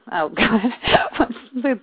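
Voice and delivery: ordinary talk, nothing marked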